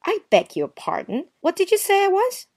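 high, indignant demand; affronted. Acted. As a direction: dare him to repeat the insult. Affronted retort.

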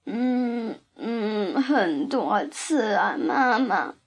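acted moaning, drawn-out words; whimpering giant.

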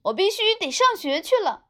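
put-on high, loud kid's echo of dad; little brother voice. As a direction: copy dad like an eager boy.

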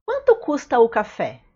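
quick, run-together question; fast natural speed. Shown as a demonstration. Teacher to learners, fast natural-speed question. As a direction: toss it off fast like a native. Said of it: "teacher says phrase at natural speed".

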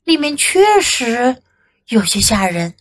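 ordinary delivery

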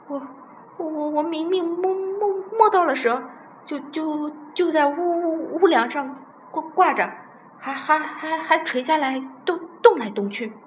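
high-pitched, badly stuttering fright; terrified stammering. A narrator's reading. Old Chen explaining where the snake hung, scared stammer. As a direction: stumble over syllables in fear. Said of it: "说起话来更加结结巴巴".

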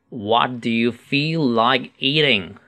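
slow model question for learners; unhurried, each word separated; slow reading. Shown as a demonstration. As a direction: read it out slowly and clearly. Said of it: "慢读一次".

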